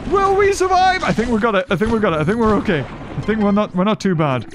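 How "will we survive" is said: high, panicked squeals; panicked high cries. Real, not acted. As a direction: panic as the fire spreads. Panicking over fire in game.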